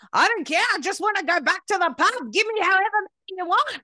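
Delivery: high, loud mimicked lady; exaggerated high-pitched demanding voice; retold lady. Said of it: "and a lady saying to me".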